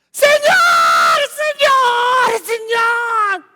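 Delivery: high, loud desperate cry to the Lord; woman begging Jesus, calling out loud. Acted. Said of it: "una cananea".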